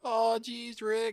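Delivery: high, imitating Rick; squeaky mock Rick sigh. Podcasters joking about Rick voice, imitating Rick.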